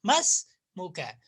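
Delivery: slow, deliberate मस्मुका as one word; मस्मुका drawn out, alif silent; slow model pronunciation. Shown as a demonstration. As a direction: model मस्मुका slowly for students. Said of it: "आप कहते हैं मस्मुका".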